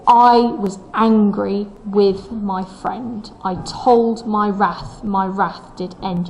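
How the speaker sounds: slow beat on "I", "angry", "friend", "told", "wrath", "end"; stressed poem rhythm. Shown as a demonstration. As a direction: mark the metre, leaning on "I", "angry", "wrath". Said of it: "You can hear the stresses".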